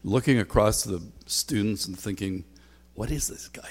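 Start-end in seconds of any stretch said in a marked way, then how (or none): 2.9-3.7 whisper. Retold or imitated. Student's private thought in chapel.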